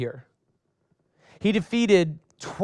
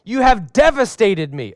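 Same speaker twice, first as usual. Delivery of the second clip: anguished cry; 6 semitones higher, 6 dB louder than usual. A narrator's reading. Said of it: "he tore his clothes and said".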